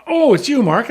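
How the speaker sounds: put-on old horse owner recognizing a friend; pleased recognition. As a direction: greet an old buddy in surprise.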